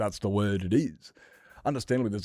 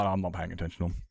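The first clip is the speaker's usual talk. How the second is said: ordinary talk, nothing marked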